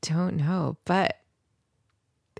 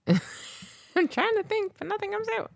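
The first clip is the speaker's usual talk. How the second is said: mock straining squeak. Co-host stuck for anything to share, straining squeak. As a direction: squeeze it out in a tiny high voice. Stuck thinking, jokey high voice.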